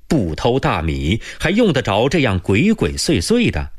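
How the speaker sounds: ordinary delivery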